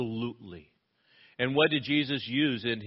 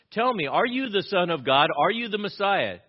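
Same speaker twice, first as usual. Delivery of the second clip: lightly voiced demanding high priest, higher and louder; acted interrogation; 7 semitones higher, 6 dB louder than usual. High priest questions Jesus about being Messiah, raised demanding voice.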